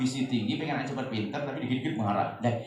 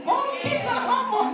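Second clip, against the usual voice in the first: laughing; 6 dB louder, slower than usual. Retold or imitated. Ibu bingung.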